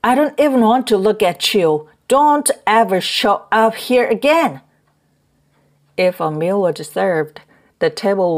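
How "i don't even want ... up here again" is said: higher, louder furious narrated shout; angry yell. A narrator's reading. Husband throwing wife into the corridor, angry yelling. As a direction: yell it to drive her out. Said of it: "he yelled".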